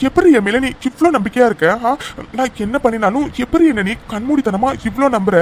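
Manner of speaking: ordinary talk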